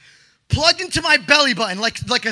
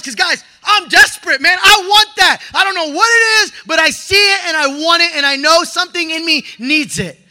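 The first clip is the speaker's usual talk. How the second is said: ordinary talk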